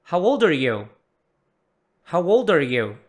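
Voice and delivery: put-on "How old are you" in one loud, joined-up run; linked words. Shown as a demonstration. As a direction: glue "old are" into "ol-dar", falling at the end. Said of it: "as palavras se juntam aqui". ("How old are you" tudo junto, mais alto)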